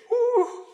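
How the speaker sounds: high-pitched "woo" of delight; thrilled onlooker's high squeal; excited whoop. Retold or imitated. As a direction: let out a high, gleeful woo. Acted thrilled onlooker reaction.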